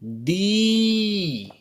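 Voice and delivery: दी with the ई held long; teacher models a long दी. Shown as a demonstration. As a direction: draw out the vowel in दी. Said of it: "और अब ये क्या बन गया?".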